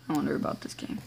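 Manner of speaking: breathy whispered aside, fast; whispered sigh. Real, not acted. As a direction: sigh and mutter it under your breath. Sighing whisper.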